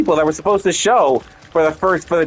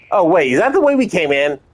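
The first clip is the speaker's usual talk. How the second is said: usual speaking voice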